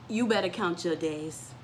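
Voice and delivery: normal talking voice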